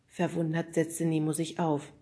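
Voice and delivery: usual speaking voice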